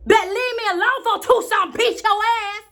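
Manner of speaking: high-pitched threat to a pest; defiant girl. Acted. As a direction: warn him off with childish fury. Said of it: "I said to the silly old boy".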